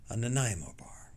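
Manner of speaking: hushed whisper. Pretend. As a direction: whisper it like a guilty treat. Whispered treat confession.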